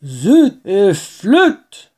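loud, drawn-out cursing; furious farmer. Acted. Boggis curses after digging all night, slow furious farmer voice. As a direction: grind out each word slowly, exasperated. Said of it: "très fatigués et furieux".

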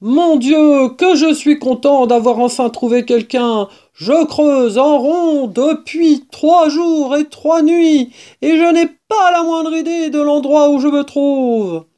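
high, loud badger voice; big, emphatic exclaiming; relieved outburst. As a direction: pour out relief and exasperation loudly. Badger relieved to find someone.

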